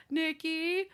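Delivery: high, drawn-out imitation of pleading; mock pleading. Frantic boyfriend searching the house, mock pleading. Acts boyfriend calling out to Nikki.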